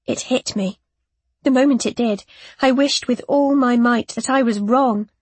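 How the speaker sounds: ordinary delivery, nothing marked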